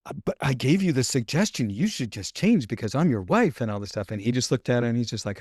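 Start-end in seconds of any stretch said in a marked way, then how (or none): 0.1-3.6 higher, insistent mock-wife protest; huffy wife imitation. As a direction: imitate a wife pressing her point, pitch swooping. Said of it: "she stomped off in a huff".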